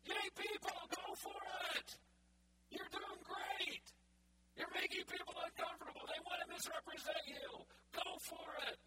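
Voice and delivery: high, loud, delighted voice of God cheering the persecuted on. Acted. God answering the preacher's complaint, loud and high with glee. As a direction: play God as an overjoyed cheerleader, pitched well up.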